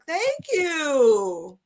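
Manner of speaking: high, drawn-out mock-polite thank you; awkward forced thanks. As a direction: squeak out thanks, not knowing how to respond. Awkward reply to being babied.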